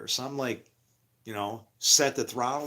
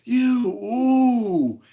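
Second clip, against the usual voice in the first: high, loud cry; imitating a startled shout; startled yell; 12 semitones higher, 10 dB louder than usual. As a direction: yell it out in alarm. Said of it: "he goes".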